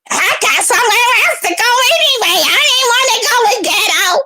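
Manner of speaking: high-pitched, whiny mocking imitation; mock let-down woman. Imitating a woman let down by her date, high mocking whine. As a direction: mimic a sore loser's excuse way up high. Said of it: "a bitch that was let down".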